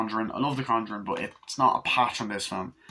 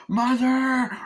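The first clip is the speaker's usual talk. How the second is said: frightened cry; 6 dB louder than usual. Retold or imitated. Possessed girl cries for mother.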